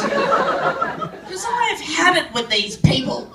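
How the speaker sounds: loud, hammed-up indignant rant; hillbilly rant. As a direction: rail at the foreigners in mock outrage. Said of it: "I'm showing my hillbilly roots".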